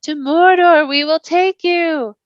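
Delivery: slow, high, otherworldly threat; eerie wraith call. Acted. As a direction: promise him Mordor, slowly, like a ghost. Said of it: "they called".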